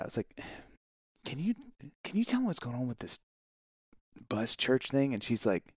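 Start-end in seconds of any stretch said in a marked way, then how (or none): 1.2-5.1 hesitant, softer start with a restart; discreet question. Retold or imitated. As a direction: imitate asking quietly for inside information. Acting his discreet, nervous question.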